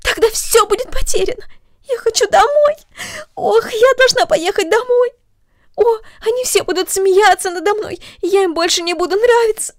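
put-on high, loud, panicked pleading girl; terrified girl crying out to the mother; frightened cry. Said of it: "закричала Корнели".

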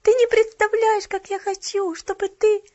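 put-on high, loud childlike pleading; imploring. A narrator's reading. Child begging the cashier to visit, pleading high and loud. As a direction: beg with urgent longing. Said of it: "взмолилась Полианна".

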